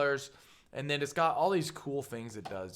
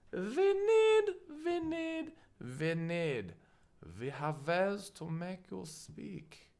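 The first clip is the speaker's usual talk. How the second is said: high-pitched mock German interrogator; German accent; 8 semitones higher, slower than usual. Presenter plays interrogator making someone speak, high German accent. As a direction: put on a slow, menacing German accent.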